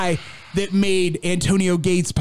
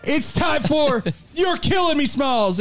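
announcer shout; 7 semitones higher than usual. Pretend. Announcing the segment.

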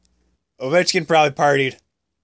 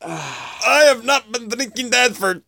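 high, slow mock-sober denial; mock Ovechkin; 8 semitones higher, slower than usual. Ovechkin voiced pretending sober before his dad, mock denial. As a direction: play a drunk insisting he's sober. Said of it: "He like".

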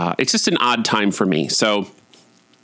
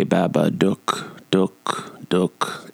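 Babadook; much slower than usual. Podcaster imitating the movie monster calling its name, creature voice. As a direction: imitate a movie monster, spacing out each eerie syllable. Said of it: "you can hear the Babadook say".

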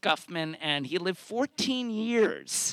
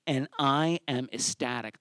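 usual speaking voice

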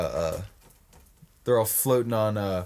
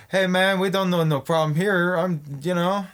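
lightly voiced higher mock Voight; mock Jon Voight; 6 semitones higher than usual. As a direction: plead it casually in a put-on voice. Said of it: "Jon Voight's like".

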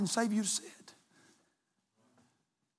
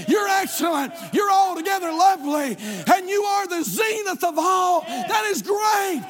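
louder, higher shouted praise list, climbing throughout; building preached litany; 6 semitones higher, 4 dB louder than usual. Acted. Pastor shouting God's names to the church. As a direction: roll the list out like a rising shout.

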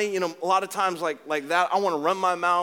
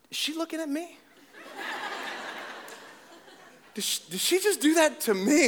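incredulous; 11 semitones higher than usual. Retold or imitated. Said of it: "I'm like".

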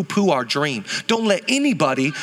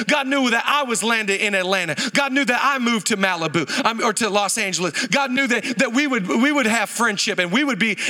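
ordinary delivery

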